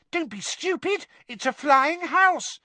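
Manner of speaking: put-on high, squeaky little boy; Dennis Creevey. A narrator's reading. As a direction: scoff excitedly in a child's high voice. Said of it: "said Dennis Creevey".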